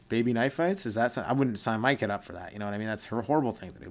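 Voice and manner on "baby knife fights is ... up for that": usual speaking voice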